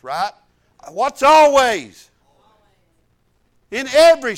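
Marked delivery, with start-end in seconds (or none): 0.9-2.1 slow, loud emphatic question; drawn-out question. Real, not acted. Preacher challenging the congregation, slow and loud. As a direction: stretch it out to make them think.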